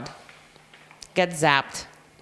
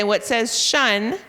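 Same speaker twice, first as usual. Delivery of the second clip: slow, deliberate teacher prompt; slow drill question; 3 semitones higher, 3 dB louder, much slower than usual. Shown as a demonstration. Teacher asking the class the phonics prompt, slowly. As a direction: draw it out for the class. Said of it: "when you say".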